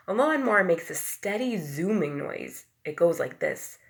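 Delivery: usual speaking voice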